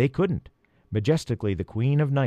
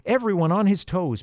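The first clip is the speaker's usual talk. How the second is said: raised-pitch instruction to the group; instructing; 6 semitones higher than usual. A narrator's reading. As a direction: give the order from below. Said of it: "Peter instructed".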